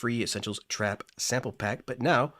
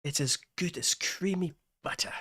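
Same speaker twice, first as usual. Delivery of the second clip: a light mock English accent, a touch higher; 6 semitones higher, 3 dB quieter than usual. Producer jokingly praising the synth in a mock English accent. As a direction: play it posh English on "creamy butter". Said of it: "try to say that in an English accent".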